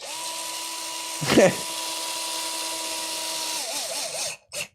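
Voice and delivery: high-pitched squealing laugh; a long rising cackle of a laugh. Real, not acted.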